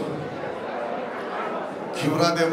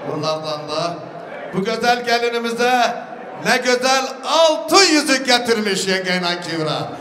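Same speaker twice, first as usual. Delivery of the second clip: booming wedding announcer; 5 semitones higher than usual. Real, not acted. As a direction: proclaim each gift to the hall. Host announcing gifts to guests. (düğünde hediyeleri gür sesle ilan eden sunucu)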